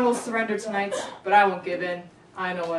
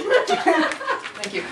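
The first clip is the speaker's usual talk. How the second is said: laughing thanks; 11 semitones higher, 4 dB louder than usual. Real, not acted. Laughing mid scene.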